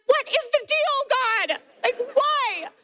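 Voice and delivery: very high, loud mock wail; hammed-up protest cried out at high pitch; exasperated outcry. Mother asking God why she is missing it, exasperated outcry.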